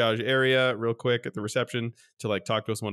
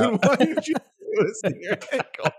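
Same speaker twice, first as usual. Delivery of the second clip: exasperated scolding; 11 semitones higher than usual. Retold or imitated. Scolding mother-in-law.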